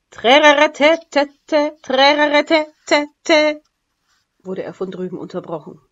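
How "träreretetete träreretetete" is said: loud, slow, higher blaring trumpet call; drawn-out voiced trumpet fanfare; trumpet imitation. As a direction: blast it out like a brass trumpet. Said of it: "Das war die Trompete".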